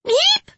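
very high startled yelp; high fairy squeak. Acted. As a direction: squeak in fright. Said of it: "the fairies cried out".

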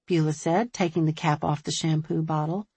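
ordinary delivery, nothing marked

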